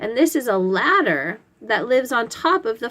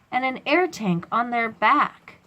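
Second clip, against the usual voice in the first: ordinary delivery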